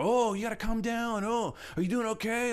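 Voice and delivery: mock worried pal checking in; concerned friend. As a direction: coax them to settle down. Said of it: "some of their friends go".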